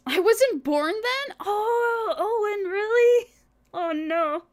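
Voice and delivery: high, loud fake sob, hammed-up; mock crying wail. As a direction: wail it like a pretend crybaby. Mock-crying over age joke.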